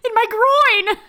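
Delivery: high loud comic yell; silly shout. Pretend. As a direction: blurt it out as a joke. Joking outburst, loud and high.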